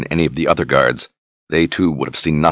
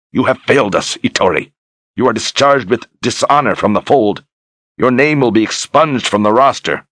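cold, raised condemnation; stern anger; 6 semitones higher, 3 dB louder than usual. Acted. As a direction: pronounce the sentence harshly. Brogan dishonors dead Ettore.

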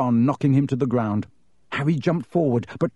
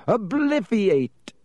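yelled spell; 8 semitones higher, slower than usual. A narrator's reading.

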